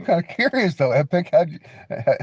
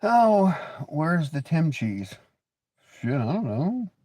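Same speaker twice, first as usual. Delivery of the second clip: usual speaking voice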